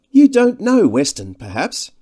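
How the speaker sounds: high, loud, airy introduction; slender Devine. A narrator's reading. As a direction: introduce your companion archly, starting high. Devine airily introduces his companion.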